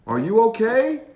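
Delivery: high, loud call up the ladder; worried dad calling up. A narrator's reading. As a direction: call up to him, concerned. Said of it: "called his dad".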